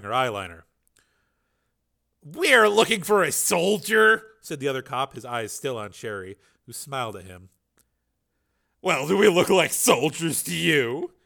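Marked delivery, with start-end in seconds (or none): 2.2-4.2 stern cop. Acted. Cop voiced by narrator. 8.8-11.1 teasing. Acted. Sherry teasing the cops.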